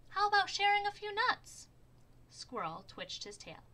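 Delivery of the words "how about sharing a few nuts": high, loud hopeful asking; eager Groundhog. A narrator's reading. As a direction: ask for nuts high and loud. Groundhog begs Squirrel.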